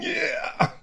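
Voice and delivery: put-on high squeaky little boy's yeah; high little boy cheer, strained tight. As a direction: cheer like a tiny kid flexing. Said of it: "in his little boy voice".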